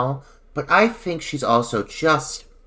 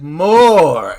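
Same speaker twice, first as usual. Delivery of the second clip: louder one-word command; curt director; 3 semitones higher, 6 dB louder than usual. Retold or imitated. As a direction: bark it like a pushy director. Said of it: "he's like".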